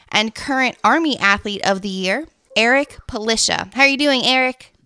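ordinary delivery

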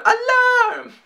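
very high, excited yells; playful belting. Pretend. Vlogger belting the song line, very high and gleeful. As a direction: belt it like a diva. Repeats it for fun.

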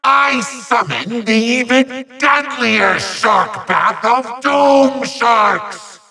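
high, stretched-out villain proclamation; grand summoning. Acted. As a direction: declaim the spell high and slow. Villain summons his sharks.

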